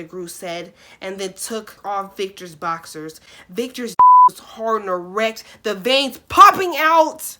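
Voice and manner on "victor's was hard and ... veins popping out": loud, emphatic reading. Acted. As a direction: stress every word loudly. Reading explicit line emphatically.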